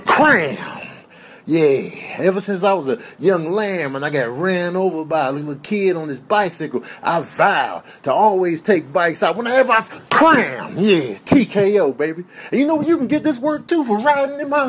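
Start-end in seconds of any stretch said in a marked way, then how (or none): 0.1-0.8 sudden high, loud exclamation; shouted attack cry. Acted. Imagined attack, cry punctuating a threat. 10.1-10.7 loud, punchy exclamation; shouted attack cry. Acted. Repeated attack cry in threat.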